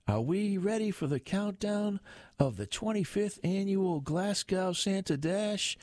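high-pitched hyped MC call; rally the crowd, voice pitched right up; yelled announcement. A narrator's reading. MC starting the countdown for the Santa Dash, yelled. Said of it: "the M P yelled".

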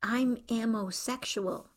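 slow lisped whisper-close line, spoken into her ear; lisping. A narrator's reading. Young daughter lisps slowly into her mother's ear. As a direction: lisp it slowly, leaning in close. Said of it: "she lisped in her ear".

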